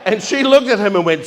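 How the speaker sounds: usual speaking voice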